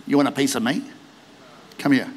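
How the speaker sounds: low, understated tough-guy taunt; mock action-hero cool; cocky challenge. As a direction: dare them to a fight, keeping it low. Acts David cockily challenging Goliath.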